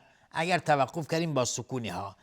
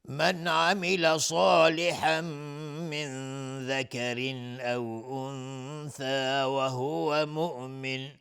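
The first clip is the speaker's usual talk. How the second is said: slow, melodic chanted recitation with long pauses; Quran recitation; 3 semitones higher, much slower than usual. Acted. Teacher reciting verse ninety-seven in slow tajweed chant. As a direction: intone each phrase slowly, stretching the vowels.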